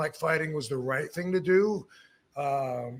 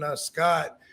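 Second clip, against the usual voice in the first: usual speaking voice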